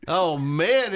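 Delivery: ordinary talk, nothing marked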